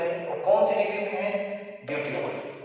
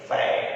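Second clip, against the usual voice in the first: फैट in a single firm, louder beat; फैट as one lower, punched syllable; one unbroken syllable; 5 semitones lower, 5 dB louder, much slower than usual. Shown as a demonstration. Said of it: "आवाज आपकी बगैर रुके हुए निकल जा रही है".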